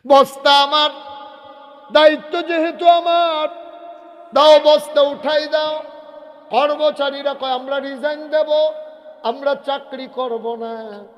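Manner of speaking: high, loud sermon storytelling; full-force preaching. Acted. Preacher acts out Umar claiming the sack, at full force. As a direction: cry it out to a huge crowd.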